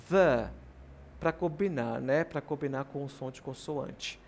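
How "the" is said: THE loud with a short uh sound; THE as "thuh". Shown as a demonstration. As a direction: punch out THE as "thuh". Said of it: "já vou pronunciar o".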